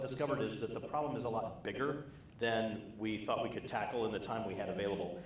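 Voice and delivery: normal talking voice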